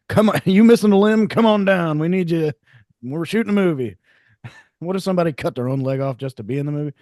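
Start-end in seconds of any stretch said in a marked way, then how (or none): 0.1-4.0 loud, higher hyped recruiter pitch; hammed-up open-call pitch; casting call announcer. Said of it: "how do they advertise? Like".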